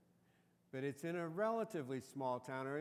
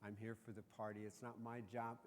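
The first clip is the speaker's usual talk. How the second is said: grumbling son; 4 semitones lower, 9 dB quieter than usual. Retold or imitated. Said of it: "He's going".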